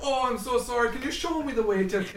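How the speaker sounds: high-pitched imitation of a sorry tourist; mock apology. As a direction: plead as a lost tourist, half laughing. Acting out lost tourists' apology.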